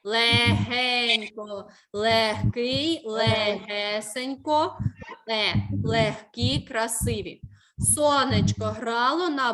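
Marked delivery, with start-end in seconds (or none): 0.0-1.7 Легенько stretched very slowly, syllable by syllable; slow teacher's demo of Легенько; drawn-out test word. Shown as a demonstration. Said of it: "тому що перевірне слово яке?". 3.0-4.8 легесенько said very slowly and spelled out; slow demo word; drawn-out test word. Shown as a demonstration. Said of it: "тому що перевірне слово яке?".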